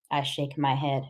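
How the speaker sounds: ordinary delivery, nothing marked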